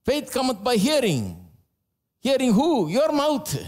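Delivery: high, rising sermon voice pressing each line home; full force preaching. Real, not acted. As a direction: preach it hard to the congregation.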